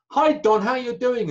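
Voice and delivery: high, loud hearty hello across the table; booming showy hello to a friend; loud friendly greeting. Retold or imitated. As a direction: greet him like an old pal, big and loud.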